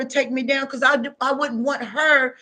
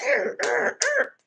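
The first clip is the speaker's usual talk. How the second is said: mock grunting; 13 semitones higher than usual. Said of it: "them men get like".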